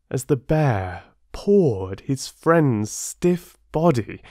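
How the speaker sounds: lively, expressive storytelling voice; story narration. A narrator's reading. Narrator telling children the bear fable, expressive. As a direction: tell the fable with drama.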